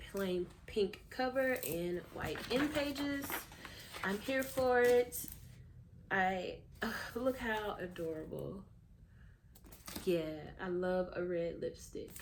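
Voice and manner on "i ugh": ordinary talk, nothing marked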